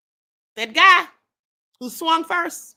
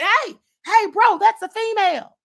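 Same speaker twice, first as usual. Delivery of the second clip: raised, urgent call to stop a fight; mild mock shout; 7 semitones higher, 5 dB louder than usual. A bystander calls out to the attacker, a mild mock shout. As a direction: plead with an attacker to stop hitting the woman. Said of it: "You heard one of them shout".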